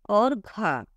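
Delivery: ordinary delivery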